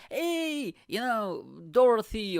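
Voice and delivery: high, sing-song birthday greeting; hammed-up high greeting to an old lady; mock party greeting. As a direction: coo at the old lady like it's her party. Voicing party guests for centenarian.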